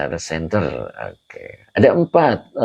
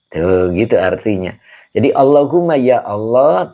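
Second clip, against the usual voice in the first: normal talking voice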